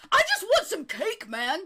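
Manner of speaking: high, loud heckle; complaining guest. Acted. Party guest in the corner wanting cake, high loud complaint. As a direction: call out plaintively from the crowd. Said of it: "Somebody goes".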